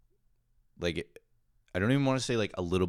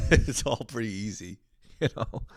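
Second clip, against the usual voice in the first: laughing through the words; 4 semitones higher than usual. Real, not acted. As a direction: giggle as you admit it's easy. Laughing at his own story.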